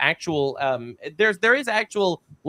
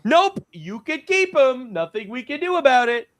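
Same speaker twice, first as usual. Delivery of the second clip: wash your hands of it, pitched up; mock refusal; 7 semitones higher than usual. Syria refusing to take refugees back, high mock dismissal. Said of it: "Like, nope".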